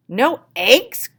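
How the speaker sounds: loud, high cry of disbelief; shocked cry. Acted. Farmer cries out at missing eggs, loud and shocked. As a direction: gasp out the words in shock. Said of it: "cried Farmer Brown".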